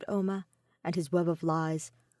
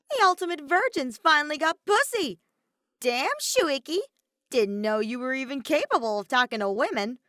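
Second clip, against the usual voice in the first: loud, high jeering mockery, much higher; mocking taunt; 6 semitones higher, 4 dB louder than usual. Iruma taunting the embarrassed detective in class, loud gleeful jeer. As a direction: rub it in with loud glee. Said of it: "as enthusiastic as ever to pick on him".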